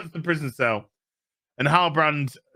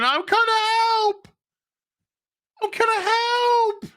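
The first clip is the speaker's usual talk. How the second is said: very high, drawn-out parody wail; hammed-up high wail, dragged slow; mock slow-motion cry; slower than usual. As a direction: stretch it out like a slow-motion heroine. Mocks slow-motion rescue cry.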